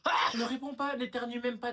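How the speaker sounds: high, loud command to shut up; angry order. Acted. Sister forbids brother to answer, angry ordering. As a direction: cut him off sharply and loudly.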